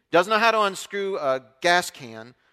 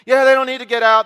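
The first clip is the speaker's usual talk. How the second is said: breezy neighbor; 6 semitones higher, 11 dB louder than usual. Retold or imitated.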